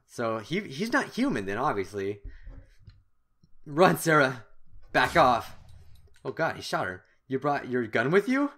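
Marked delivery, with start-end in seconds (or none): 3.6-5.6 louder, urgent command; urgent shout. Acted. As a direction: push Sarah to run. Shouting to Sarah in danger.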